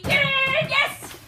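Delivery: high excited cheer. Real, not acted. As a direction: cheer the dog on loudly. Cheering the dog on.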